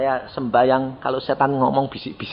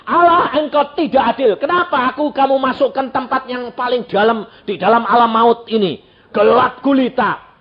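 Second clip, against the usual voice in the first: higher, louder whining protest; a lightly voiced sinner accusing God; aggrieved accusation; complaining damned man; 6 semitones higher, 3 dB louder than usual. Wicked dead man accuses God.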